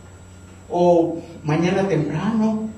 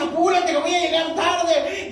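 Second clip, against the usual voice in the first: urgent Laura; 11 semitones higher than usual. Retold or imitated. Late woman urging taxi driver.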